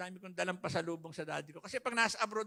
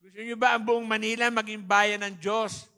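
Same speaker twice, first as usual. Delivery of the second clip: much louder, pushing for a bigger answer; louder repeat; 2 semitones higher, 10 dB louder than usual. Real, not acted. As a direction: demand a stronger response. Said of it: "Ang hina ng sagot".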